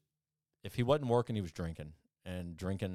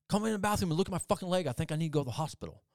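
higher, louder, fast urgent plea; 8 semitones higher, 4 dB louder, much faster than usual. Retold or imitated. Elmer waking his hungover friend, fast urgent plea. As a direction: rush it like something's badly wrong.

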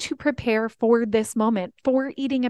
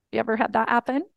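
ordinary delivery

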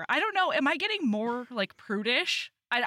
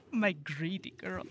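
put-on Russian accent; 3 semitones lower, 5 dB quieter than usual. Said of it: "in a Russian accent".

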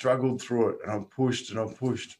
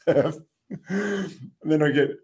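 normal talking voice

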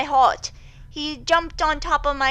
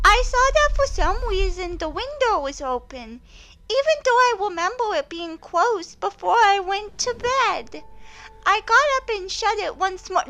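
put-on high cutesy little boy voice; squeaky baby-talk kid; uwu voice; 7 semitones higher than usual. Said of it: "do this in an uwu voice".

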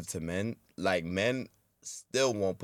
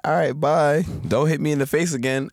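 loud mock; 4 semitones higher, 7 dB louder than usual. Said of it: "It's like".

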